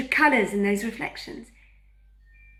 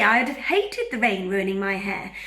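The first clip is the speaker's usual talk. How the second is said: ordinary delivery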